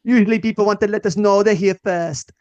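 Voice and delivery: high, loud, hammed-up Jamaican accent; a bad put-on Jamaican accent; fake Jamaican accent. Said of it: "You are doing it about as good as she did".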